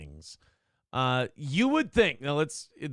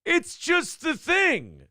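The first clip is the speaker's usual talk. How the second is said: much higher, slow mock-innocent protest; defensive protest; 12 semitones higher, slower than usual. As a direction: play the innocent, drawn out and high. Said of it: "I'm like".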